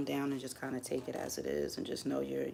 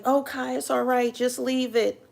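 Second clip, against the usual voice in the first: high, loud coaxing coworker voice; mock coworkers urging her to let it go, higher and louder; coworkers' coaxing; 10 semitones higher, 8 dB louder than usual. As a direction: soothe her like worried coworkers, pitched way up. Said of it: "they be like".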